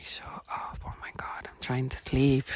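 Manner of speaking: hushed, grumpy whisper; half-asleep and fed up, breathe it out; irritated whisper. Retold or imitated. Sleepy patient telling the nagging voice to shut up, whispered. Annoyed at nurse waking her.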